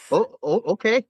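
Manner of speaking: high sheepish oh okay; sheepish realization. Retold or imitated. Medic caught misreading the captain, sheepish high okay.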